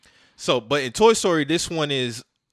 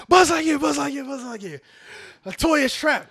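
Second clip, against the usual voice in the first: urgent calling; 7 semitones higher than usual. Retold or imitated. Woody luring Buzz, high urgent calling. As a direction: call out for him like a plea.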